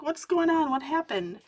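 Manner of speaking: mother shouting in panic on hearing her daughter cry; alarmed shout. Retold or imitated. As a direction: cry out in alarm for your child. Mom rushes to crying daughter.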